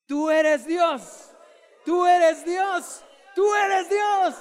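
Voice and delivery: slow, loud, high chant; shout together. Real, not acted. Preacher leads everyone shouting together, loud. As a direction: lead the congregation in a roaring call. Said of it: "Digamos todos juntos".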